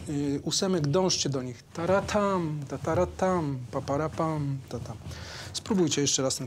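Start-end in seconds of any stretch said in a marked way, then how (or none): 1.7-5.0 sung rhythm stressed on two; accent on two. Shown as a demonstration. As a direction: sing taratam leaning on the second beat. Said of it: "akcenty na dwa".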